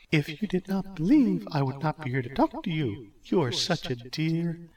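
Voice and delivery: Hera, sweet. Acted. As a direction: flatter him gently.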